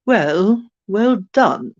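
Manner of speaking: playful put-on British accent. Showing off funny voices to viewers, British accent. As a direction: say it in a light mock-British voice. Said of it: "What, my British accent?".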